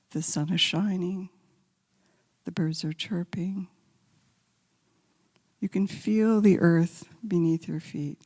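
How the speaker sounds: a calm voice guiding listeners with their eyes closed; guided meditation. Acted. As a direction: lead them gently along the path.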